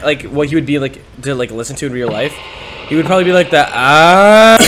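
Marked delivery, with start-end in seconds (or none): none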